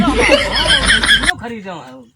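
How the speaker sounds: laughing. Acted. Comic skit, boasting buyer laughs.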